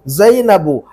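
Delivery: ज़ैनबु ending on a short u, no final n; ज़ैनबु बिना तनवीन, छोटे उ पर ख़त्म; Arabic ending without nunation. Shown as a demonstration. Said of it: "अब यहाँ पर ज़ैनबुन नहीं कहेंगे हम".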